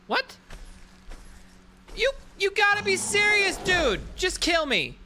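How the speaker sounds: high, disbelieving outburst; exasperated. Real, not acted. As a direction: pitch up in outrage. Killed again.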